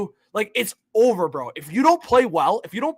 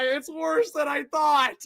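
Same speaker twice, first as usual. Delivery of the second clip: exasperated cry; 6 semitones higher, 3 dB quieter, slower than usual. Real, not acted. Reacting to the trade.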